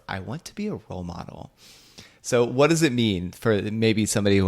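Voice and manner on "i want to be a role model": pageant voice. Retold or imitated. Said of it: "the pageant voice for".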